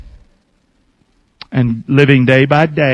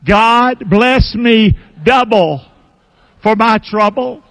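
slow, high leading chant; call and response; 7 semitones higher, slower than usual. Shown as a demonstration. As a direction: draw each word out for the crowd. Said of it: "one more time".

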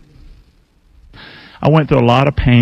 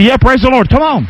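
excited praise; 10 semitones higher than usual. Real, not acted. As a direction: burst out in praise. Preacher exclaims praise.

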